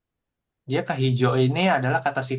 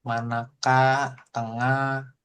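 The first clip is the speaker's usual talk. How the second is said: slow word model; much slower than usual. Shown as a demonstration. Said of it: "new word demo".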